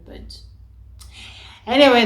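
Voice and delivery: ordinary talk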